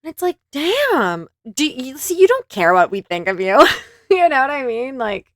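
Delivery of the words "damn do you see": ordinary talk, nothing marked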